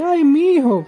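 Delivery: high, loud syrupy "my son"; mock fawning king. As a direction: ham up a flattering king.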